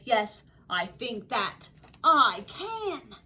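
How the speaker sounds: deliberate, emphatic agreement; resolute yes. Acted. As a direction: draw it out firmly at normal pitch. Kevin agrees, second take.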